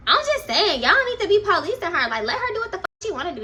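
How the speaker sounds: high, pushy, speeding up at the end; stubborn, unbothered retort; mock defiant sass. Darnisha answering the laughing friends, in high, defiant sass.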